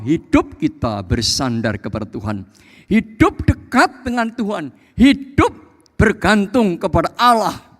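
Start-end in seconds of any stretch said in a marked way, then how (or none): 2.9-7.7 high, raised preacher's voice; forceful, punched phrases; emphatic preaching. Real, not acted. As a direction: drive the point home to the congregation. Preacher drives home his point.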